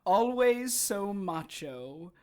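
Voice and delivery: slow, high sneer; mocking. Kay mocking Harry's bravado, slow and sneering. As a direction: drag it out to belittle him.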